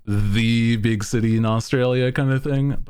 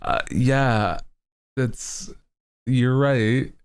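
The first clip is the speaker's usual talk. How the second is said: ordinary delivery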